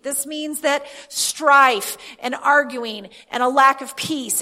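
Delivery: driving, rhythmic list of declarations; emphatic preaching. Real, not acted. Preacher declares what is under his feet, emphatic preaching. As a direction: hammer the key word in each repeated phrase.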